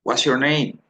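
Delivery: smooth rapid demo. Shown as a demonstration.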